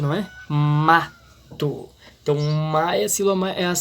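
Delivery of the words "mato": "Mato" with MA drawn out and loud, TO weaker; stress on MA. Shown as a demonstration. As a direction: lean hard on the MA of "Mato", let TO drop. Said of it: "Porque ela é a mais forte". ("Mato" com ênfase forte no MA)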